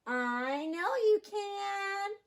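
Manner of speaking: slow, lifted, coaxing voice; encouraging mother duck. A narrator's reading. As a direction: reassure the ducklings, stretching it out. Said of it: "Mama said".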